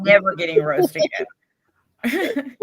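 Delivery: slow, laughing repeat. Retold or imitated.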